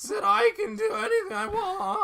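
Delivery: very high, loud falsetto wail; piercing mock tantrum, drawn out; childish falsetto. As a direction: bawl it like a giant toddler. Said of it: "like, falsetto".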